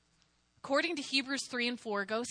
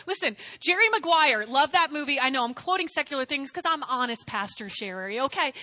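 normal talking voice

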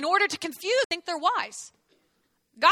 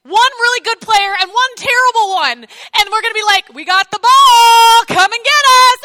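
football coach; 8 semitones higher, 15 dB louder than usual. Retold or imitated. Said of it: "like a football coach going".

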